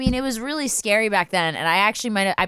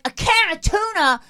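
high-pitched indignant outburst; acted-out furious complaint; indignant rant; 11 semitones higher, 4 dB louder than usual. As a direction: play an outraged stranger rejecting a gift. Homeless woman rejects the groceries.

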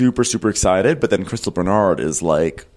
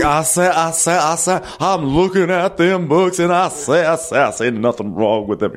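Foghorn Leghorn; 8 semitones higher than usual. Retold or imitated. Imitating the actress slipping into a Southern cartoon accent. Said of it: "just suddenly just goes into Foghorn Leghorn".

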